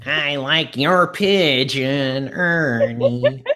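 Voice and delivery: very slow, drawn-out mock Muppet; puppet character voice. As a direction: play a puppet, stretching every word. Acts out Muppet addressing Ernie.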